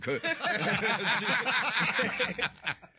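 high loud burst of laughter; a real laugh, long and loud. Real, not acted. Laughs at friend's call.

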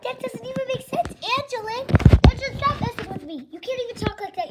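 high, exasperated scolding. Acted. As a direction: tell off a sibling who's messing with you. Child annoyed at sister's robot act.

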